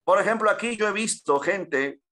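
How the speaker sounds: ordinary talk, nothing marked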